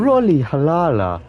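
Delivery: Rolly Lala slow, smooth, Lala drawn out; imitating the translator. Streamer copying Google Translate's pronunciation, slowly. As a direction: copy the machine's tune. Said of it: "but then they go".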